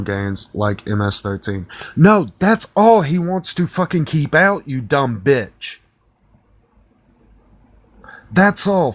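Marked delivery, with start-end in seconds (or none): 2.0-8.9 angry rant. Real, not acted. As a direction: snap angrily at the writer. Ranting at the journalist.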